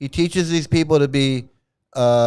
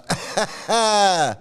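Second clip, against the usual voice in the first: amused laugh; 5 semitones higher than usual. Real, not acted. Laughs at the quoted lyric.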